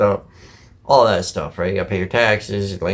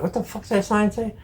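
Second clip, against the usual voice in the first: high, soft, squinting disbelief; a high, hushed what-the-heck; baffled exclaim; 10 semitones higher, 6 dB quieter than usual. Retold or imitated. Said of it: "I'm like".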